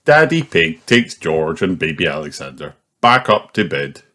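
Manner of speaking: plain reading voice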